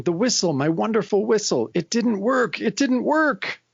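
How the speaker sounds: high mock lament climbing in pitch; dismayed villain. Sarnak as his whistle has no effect, mock dismay.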